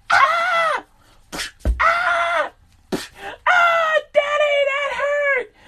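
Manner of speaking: high, loud fake cries of pain; fake yelling child. The son faking screams so the mother hears, loud and high. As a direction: scream like you're being whipped, but hammed-up. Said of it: "You go".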